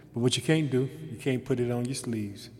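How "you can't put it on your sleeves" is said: ordinary talk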